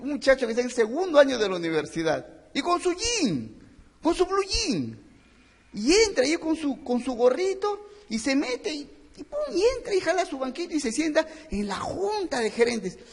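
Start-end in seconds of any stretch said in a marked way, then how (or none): 2.5-13.0 mocking the young upstart. Describing the cocky young student.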